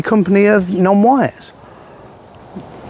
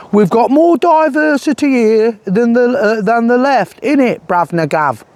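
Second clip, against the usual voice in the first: raised-pitch fake London geezer; mock London accent; 8 semitones higher than usual. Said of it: "I'm using this accent because Tommy's fake accent".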